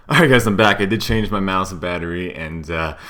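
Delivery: panting after running upstairs; breathless, catching air between words; out of breath. Real, not acted. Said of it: "I'm out of breath 'cause I just ran up the stairs".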